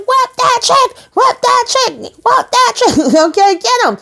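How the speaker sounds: very high squealed chant, breaking into a laugh; gleeful cheering. Retold or imitated. Vlogger cheering on Fatima's fight, gleeful squealing. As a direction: egg the fighter on in a giddy squeal.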